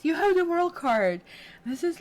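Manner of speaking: high, loud excited exclamation; thrilled, pitch and volume leaping up; real excitement. Real, not acted. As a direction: burst out delighted at a lucky card. Thrilled at the card drawn.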